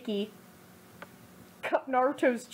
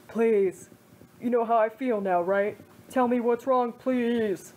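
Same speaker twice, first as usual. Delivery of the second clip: pained begging; slower than usual. Acted. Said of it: "Kiba begged the crying man, pain clearly shown in his voice".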